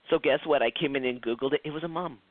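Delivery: ordinary talk, nothing marked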